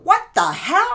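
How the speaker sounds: high, loud mock disbelief; retold shock. Therapist catching her own bad posture in the mirror, mock-shocked. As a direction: blurt it out high, imitating your own shock.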